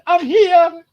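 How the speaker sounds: high, loud call for attention; cat calling out. Retold or imitated. Invisible cat calling loudly to be noticed. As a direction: shout it high so they notice. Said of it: "they're going".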